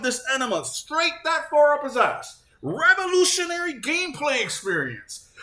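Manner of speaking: high-pitched, stretched-out incredulous ranting; exasperated mocking rant. Gamer ranting at the host's gushing over Kojima, high mocking exasperation. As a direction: pitch way up, drawing out the mockery.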